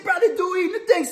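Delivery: high, hollering disbelief; mock yell.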